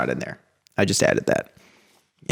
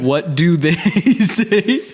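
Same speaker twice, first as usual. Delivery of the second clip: imitating a drawn-out question; much slower than usual. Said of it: "and then you just went".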